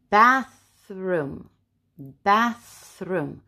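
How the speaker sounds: BATHROOM split by a long breath of th; unvoiced TH. Shown as a demonstration. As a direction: stretch the voiceless th in BATHROOM. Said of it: "Bathroom makes the th sound".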